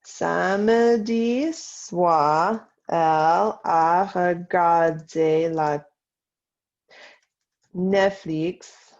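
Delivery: very slow French, syllable by syllable; slow dictation. Shown as a demonstration. Teacher to class writing about Saturday night, slow dictation. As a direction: dictate slowly with long gaps between phrases. Dictation, later repeated at speed.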